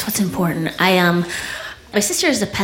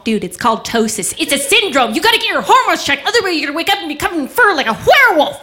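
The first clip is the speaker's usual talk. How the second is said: high, swooping, fast warning; sister's hectoring; 7 semitones higher, faster than usual. Retold or imitated. Older sister warning about hormones, hectoring high rush. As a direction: lecture urgently, racing up to the last word. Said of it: "she's always giving me a hard time".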